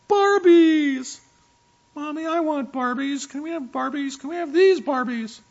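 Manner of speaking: put-on high, loud begging little girl voice; shrill-pitched child pleading for Barbies; whiny little girl. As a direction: beg like an excited eight-year-old. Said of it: "Sounding just like that".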